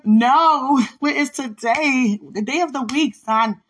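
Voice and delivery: loud, high, slow exasperation; laughing through the frustration; exasperated mom. Retold or imitated. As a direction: mom losing patience with her son.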